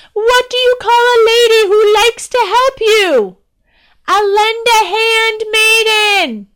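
shouting to the crowd. Acted. Princess shouting jokes from the carriage, loud and high. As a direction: call it across the street to the townspeople.

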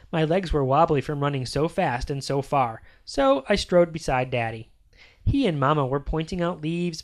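usual speaking voice

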